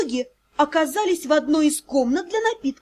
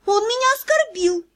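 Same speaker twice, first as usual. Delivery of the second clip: high, whiny complaint; plaintive complaint; 8 semitones higher than usual. Acted. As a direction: complain like a wronged girl. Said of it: "жалобным голоском протянула она". (жалобным голоском)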